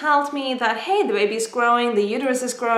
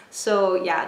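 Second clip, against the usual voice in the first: ordinary talk, nothing marked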